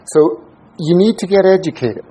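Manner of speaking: normal talking voice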